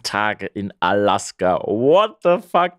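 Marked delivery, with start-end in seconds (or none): 1.6-2.7 high, drawn-out disbelief; pitched-up slow what the fuck; amazed outburst. Real, not acted. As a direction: blurt it out, stunned by the news. Amazed at Alaska trip news.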